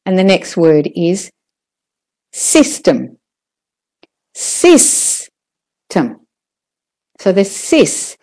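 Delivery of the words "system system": "system" with a long SIS; stress on SIS. Shown as a demonstration. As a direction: stretch the first syllable of "system", short TEM. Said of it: "is louder and longer".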